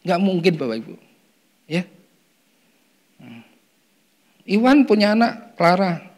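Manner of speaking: usual speaking voice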